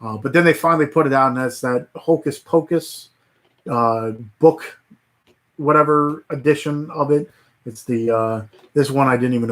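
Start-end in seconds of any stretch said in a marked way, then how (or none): none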